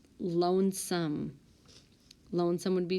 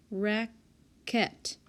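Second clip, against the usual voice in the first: "Racket" drawn out as rac, then ket; syllable by syllable; 3 semitones higher than usual. Shown as a demonstration. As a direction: break "Racket" in two with a clear gap. Sounds out word to find syllable.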